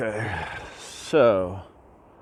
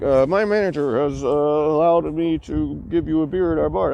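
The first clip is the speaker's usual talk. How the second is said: lightly imitated Indian English, very courteous; polite Indian accent; 6 semitones higher, 4 dB louder than usual. Hotel clerk offering a guest a beer, polite mock Indian accent. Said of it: "with Indian accent, but very polite".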